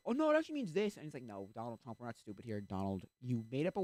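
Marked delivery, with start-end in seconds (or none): 0.1-1.0 high, loud mock backpedaling; mock defensive protest. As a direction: imitate a loud, high-pitched excuse. Mimics Trump's defensive excuse.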